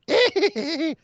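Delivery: high, cackling witch laugh; a hammed-up witch cackle with a cone on the head. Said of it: "like a witch".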